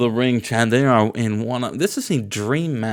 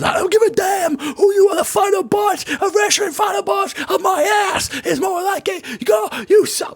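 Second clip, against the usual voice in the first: Scott Steiner impression. Acting out Scott Steiner cutting a promo on Misawa, high shouted rant. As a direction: scream it like a ranting heel wrestler. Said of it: "and he says".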